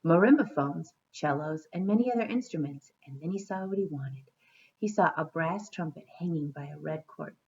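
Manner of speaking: storybook narration; story reading. A narrator's reading. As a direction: read to children at an even pace. Reads a storybook aloud.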